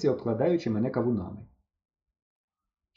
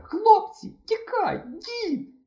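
дуже високо, пронизливо; крикни на тривогу; пронизливий крик; much slower than usual. A narrator's reading. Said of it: "пронизливий крик Антончика". (Антончик пронизливо кричить хлопцям)

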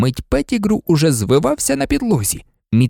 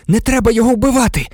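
high, fast, urgent plea; breathless plea; 9 semitones higher, 4 dB louder, faster than usual. A narrator's reading. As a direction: rush it out to stop a killing. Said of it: "сказав він задихано". (задихано, швидко й високо)

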